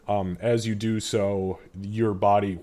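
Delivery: ordinary talk, nothing marked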